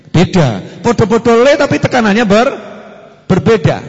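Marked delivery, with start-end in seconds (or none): none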